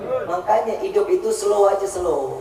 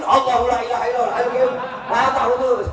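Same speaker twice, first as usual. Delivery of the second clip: fast, raised chanting of the verse; recited louder; 4 semitones higher, faster than usual. Shown as a demonstration. Husband chants louder still as nagging grows. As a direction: chant it out quickly and more strongly. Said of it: "Agak dikencengin".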